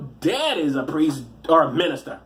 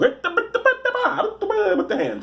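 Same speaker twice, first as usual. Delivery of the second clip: high, babbling mock Italian accent; laughing Italian caricature; much slower than usual. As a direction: gabble nonsense in cartoon Italian accent, then land the words.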